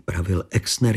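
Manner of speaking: usual speaking voice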